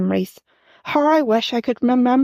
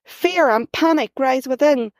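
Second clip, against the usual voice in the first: ordinary delivery, nothing marked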